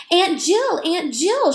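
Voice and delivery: high, loud screech for her aunt. A narrator's reading. Girl screeching for her aunt. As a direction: shriek her aunt's name in alarm. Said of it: "she screeched".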